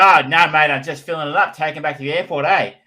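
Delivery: put-on broad Aussie accent starting loud and high; exaggerated Australian. Tourist explaining he is returning the hire car, hammed-up Australian accent. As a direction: ham up the Australian, with a Queensland "eh". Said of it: "significantly more Australian".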